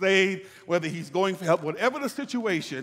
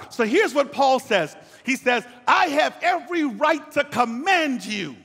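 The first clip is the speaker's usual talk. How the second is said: raised, emphatic pulpit voice; preaching; 5 semitones higher, 3 dB louder than usual. Acted. Pastor driving the point home to his church, preaching. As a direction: preach it to the congregation.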